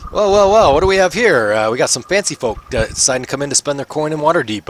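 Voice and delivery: a smug guard sizing up the newcomers; mocking gate guard. Gate guard greeting the fancy adventurers, mocking sneer.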